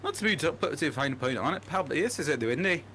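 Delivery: quick broad Scottish accent. Shown as a demonstration. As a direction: rattle it off like a Glaswegian. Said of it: "Scottish accents".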